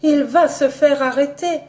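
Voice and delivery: put-on high, frantic young woman; panicked exclamation. A narrator's reading. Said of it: "Colette, affolée, s'exclamait".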